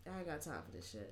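low, soft, dismissive aside; unspoken brush-off. Retold or imitated. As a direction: voice a silent, weary thought. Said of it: "He doesn't say it, but that's what his face says".

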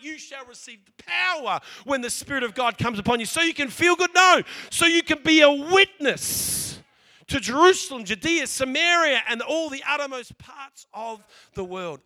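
full-force sermon delivery; preaching. Acted. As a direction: thunder the scripture over the crowd.

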